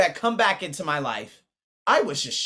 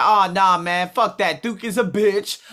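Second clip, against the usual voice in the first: mock loud dismissal; 4 semitones higher, 8 dB louder than usual. Quoting his own dismissal.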